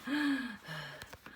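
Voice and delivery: heavy acted gasps for breath; exhausted panting. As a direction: pant as if after a long run. Out of breath after running.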